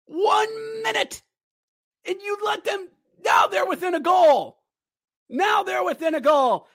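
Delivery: high shouting in disbelief; furious fan screaming; outraged yelling. Real, not acted. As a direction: yell at the team in outrage. Fan outraged at late goal.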